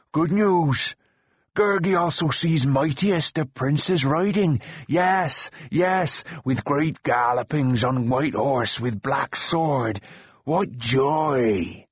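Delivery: joyful creature. A narrator's reading. Gurgi announces the prince is riding, joyful creature voice. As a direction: savour the good news as an excited creature. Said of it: "Gurgi hurried on".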